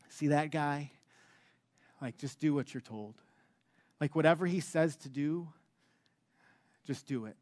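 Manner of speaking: very soft and low, confiding; imitating a quiet, understated word to the servants; hushed instruction.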